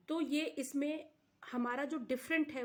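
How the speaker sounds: ordinary talk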